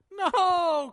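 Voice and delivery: high, loud mock wail; crying child's wail. Girl running from her discovered mischief, imitated crying wail.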